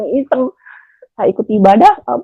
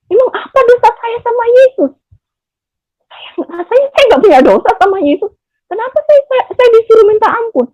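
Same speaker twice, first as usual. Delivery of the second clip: indignant; 12 semitones higher than usual. Retold or imitated. Indignant retold objection.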